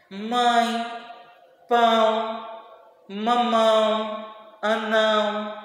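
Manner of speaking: "Mãe, pão, mamão, anão" each drawn out through the nose; word by word, let the nose buzz on "Mãe, pão, mamão, anão"; nasal vowels. Shown as a demonstration. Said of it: "a gente percebe esse vibrado no nosso nariz". ("Mãe, pão, mamão, anão" com som nasal, uma palavra por vez)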